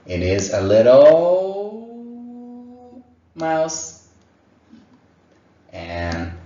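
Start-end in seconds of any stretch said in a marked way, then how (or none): 0.1-4.0 higher, louder squeaky voice for little mouse. Shown as a demonstration. Teacher answering in a mouse voice, squeaky. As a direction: sound tiny and mousey.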